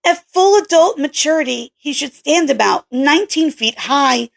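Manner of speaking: a hint of a brusque young scientist; Kelly's voice. Kelly's voice held.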